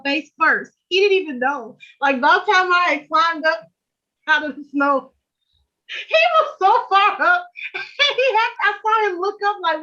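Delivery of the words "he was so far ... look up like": high, giggly laughter through the words; laughing while telling. Real, not acted. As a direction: laugh it out as you tell it.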